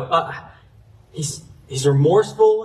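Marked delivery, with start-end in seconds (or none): none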